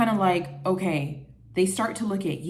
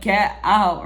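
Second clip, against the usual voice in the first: mock firm get out; 7 dB louder than usual. Retold telling copycat off.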